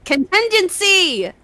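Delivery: high, drawn-out call of the word; triumphant correction. Real, not acted. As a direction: belt out the word for a stumbling friend. Supplies word the co-reader fumbled.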